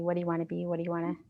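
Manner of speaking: ordinary talk, nothing marked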